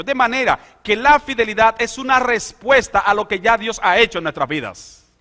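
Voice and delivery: high, loud fervent preaching; full-force preaching. Acted. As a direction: preach it out to the whole congregation.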